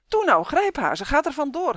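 high, loud pleading shriek. Acted. Dwarf girl shrieks at the boy to grab the hen, high and loud. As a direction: scream it urgently, high and loud. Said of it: "gilde het dwergmeisje".

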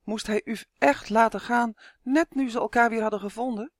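ordinary delivery, nothing marked

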